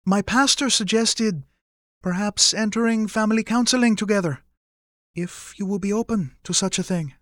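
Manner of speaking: high-pitched mother making a careful proposal; hopeful, tentative mother. Acted. Mother proposing family counseling to her son, tentative.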